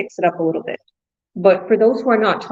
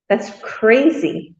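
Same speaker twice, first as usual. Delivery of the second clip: ordinary delivery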